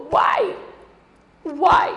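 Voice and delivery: high, loud pleading "Why?"; crying out to God. Retold or imitated. Mother reliving begging God over her sick son, loud plea. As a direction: beg the heavens with each "Why?". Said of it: "I cried out to God. I begged him".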